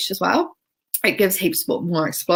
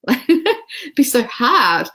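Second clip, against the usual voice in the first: laughing it off, higher and slow; 7 semitones higher, 3 dB louder, much slower than usual. Real, not acted. Laughing to viewers about writing a training. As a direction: chuckle through the words.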